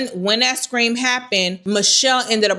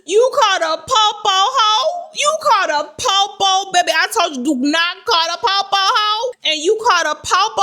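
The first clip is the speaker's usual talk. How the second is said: mock scolding; 10 semitones higher than usual. Scolding the family for calling police, high and sassy. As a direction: tell them off in a shrill, sassy voice.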